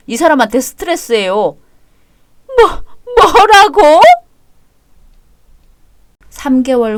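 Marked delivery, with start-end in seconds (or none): 2.6-4.0 tearful shriek. Acted. Mother shrieks in disbelief.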